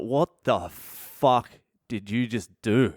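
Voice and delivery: slow, drawn-out incredulous question; retold stunned disbelief. Old geezer to the man who broke his glasses, slow disbelief. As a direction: imitate someone appalled, leaving gaps between words.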